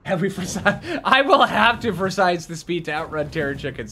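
normal talking voice

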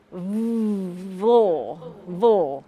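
vo, quick consonant into the vowel; vo syllable demo. Shown as a demonstration. Teacher drilling vo for students. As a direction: slide v straight into o. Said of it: "the last syllable".

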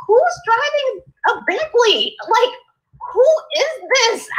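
high, amazed disbelief; incredulous. Retold or imitated. Neighbor spotting a Bentley nearby, high incredulous amazement. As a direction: gawk at a passing luxury car in disbelief. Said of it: "and I'm like".

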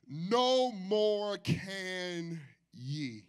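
slow, drawn-out verse. Acted. Text drawn out slowly.

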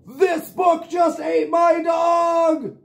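put-on high, slow shouted girl's alarm; Bella's cry. Bella shouting that the book ate her dog. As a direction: cry out as the girl. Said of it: "Bella said".

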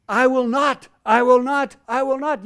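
loud, high, hammered repetition; emphatic. Acted. Preacher repeats God's vow to the church, loud and emphatic. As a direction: pound each I will not harder. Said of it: "You can't get much more emphatic than that".

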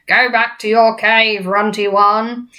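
loud, slow giant order; drawn-out brutish dismissal; bully giant. Acted. Giants sending BFG back to cave, bullying shout.

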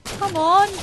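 high, loud chase shout; frantic gamer shout; shouting at enemy. Real, not acted. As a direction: yell after the fleeing player.

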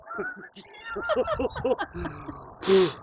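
high breathless laugh from cold shock; shocked breathless laughter. Real, not acted. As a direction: laugh through the freezing shock. Just drenched in ice water.

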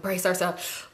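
hushed. Retold or imitated. Sneaking up to the door.

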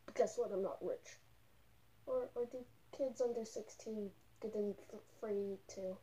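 muffled inside costume head; speaking behind full mask; through a fursuit head. Real, not acted. Seen 3: Speaker wears a full furry costume head in every frame.

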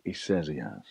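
imitating a sceptical friend quietly; cynical. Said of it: "her mate was a bit more cynical".

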